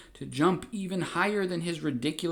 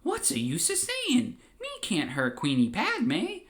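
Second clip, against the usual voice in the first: high, shocked Gungan voice; horrified Jar Jar; 9 semitones higher than usual. Acted. Jar Jar refusing Palpatine's order, high horrified voice. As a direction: recoil in goofy horror. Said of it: "absolutely horrified and says".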